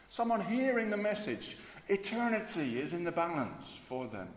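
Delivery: loud, high, urgent sermon climax; passionate preaching. Acted. Preacher urging the congregation, loud and high-pitched. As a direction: preach it like heaven and hell hang on it. Preacher builds to full force.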